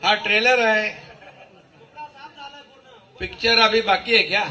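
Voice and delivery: loud laughing rally shout; roaring rallying speech. Real, not acted. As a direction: fire up the crowd, laughing. Rally speech climax to crowd.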